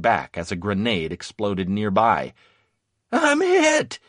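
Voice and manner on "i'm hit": high, loud panicked scream. A narrator's reading. Wounded Victor crying out to his comrades, screamed. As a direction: scream it out, pitched way up. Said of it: "he screamed".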